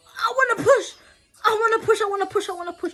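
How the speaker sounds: high, loud, desperate shout; urgent shouting. Retold or imitated. Mother in labour shouting at nurse, desperate. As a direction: cry out that she has to push. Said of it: "I was just like".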